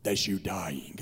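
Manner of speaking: very low, slow ominous preacher voice; imitated revival preacher. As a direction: drop deep and draw it out, mock-doom. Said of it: "This is what he did on a regular basis".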